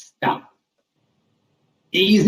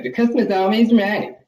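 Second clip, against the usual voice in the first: mocking customer; 5 semitones higher than usual.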